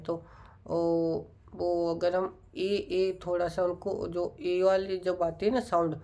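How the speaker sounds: ordinary delivery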